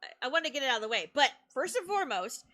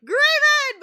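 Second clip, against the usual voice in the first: loud high call across the room; excited shouted greeting; shouted name; 12 semitones higher, 12 dB louder than usual. Retold or imitated. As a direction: yell his name out happily. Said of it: "I will say".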